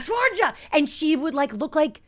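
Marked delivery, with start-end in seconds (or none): 0.0-0.5 high scolding call; exasperated big brother. Retold or imitated. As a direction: call her name in exasperation. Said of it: "he'd be like".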